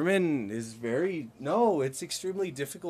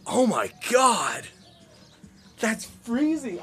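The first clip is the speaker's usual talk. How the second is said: loud, drawn-out, higher exclamation; shocked disbelief; 7 semitones higher, 7 dB louder, slower than usual. Real, not acted. Streamer hearing how cold Germany is, shocked. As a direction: stretch it out, aghast at the cold.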